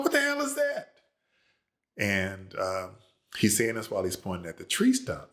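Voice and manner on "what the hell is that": shrieking, very high frightened cry; startled scream. Retold or imitated. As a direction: yelp in shock at the snakes. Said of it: "screams at the top of his lungs".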